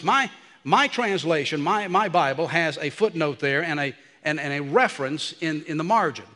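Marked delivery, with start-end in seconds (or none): none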